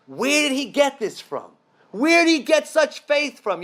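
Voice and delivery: high, amazed questioning; blown away. Retold or imitated. Four rabbis marvelling at Akiva's faith, amazed. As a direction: marvel at it as you ask. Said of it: "rabbis were blown away".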